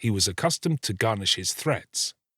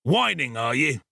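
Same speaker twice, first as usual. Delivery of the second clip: lightly voiced bullying robber, pitched up; scolding ruffian; 5 semitones higher than usual. A narrator's reading. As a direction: sneer at her a little as a ruffian. Sikes scolds the girl after striking.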